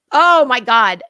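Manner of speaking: oh my God, burst out loud; loud exclamation. Real, not acted. Seeing [name]'s dress, loud amazed exclamation. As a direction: blurt it out amazed.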